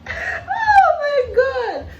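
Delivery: slow, high laughing cry; drawn-out helpless laugh; real laughter while retelling; laughing hard. Real, not acted. Still laughing at memory.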